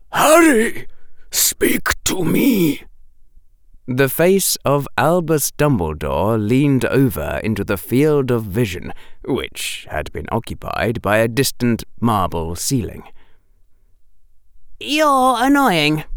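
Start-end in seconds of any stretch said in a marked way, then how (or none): 0.1-2.8 high, urgent, gasping plea; anxious raised cry to someone hurt; gasped plea. A narrator's reading. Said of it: "gasped a voice". 14.8-16.0 high, slow, hollow monotone; eerie, emotionless held-pitch voice; empty voice. A narrator's reading. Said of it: "said the empty voice".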